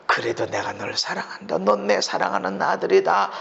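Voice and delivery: low, soft, gentle assurance; tender voice of God. Acted. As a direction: speak as a loving father.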